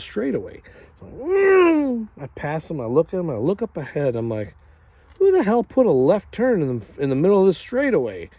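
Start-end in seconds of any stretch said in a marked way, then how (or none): 1.0-2.1 loud, high vroom; mimicked engine roar. Rider telling how he passed a Porsche, mimicked engine roar. 5.2-8.3 loud, high-pitched outraged exclamation; indignant disbelief. Retold or imitated. Speeding rider spots a left turn, acted indignant disbelief.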